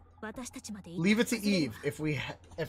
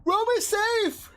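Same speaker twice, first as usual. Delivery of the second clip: relieved cry; 13 semitones higher, slower than usual. Acted. Relieved cry after scare.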